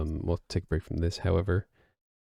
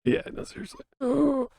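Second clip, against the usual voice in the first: surprised squeak; 4 dB louder, slower than usual. Real, not acted. Reacting with surprise.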